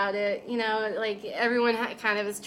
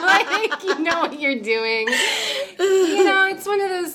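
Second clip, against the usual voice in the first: laughing; 8 semitones higher, 7 dB louder, slower than usual. Real, not acted. Laughing while describing job calls.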